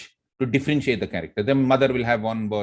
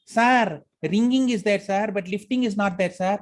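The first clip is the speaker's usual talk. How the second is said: higher, eager secretary voice with a foreign lilt; Lily's accent; 8 semitones higher than usual. Retold or imitated. Secretary Lily assuring her boss, in her own accent. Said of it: "how I changed my voice".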